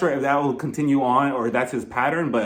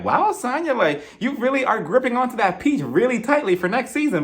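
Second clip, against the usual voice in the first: much higher mock shock; sarcastic disbelief; 8 semitones higher than usual. Vlogger reacting to Sanya's question, high mock amazement. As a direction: act stunned at her nerve, pitched up. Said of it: "And I'm like".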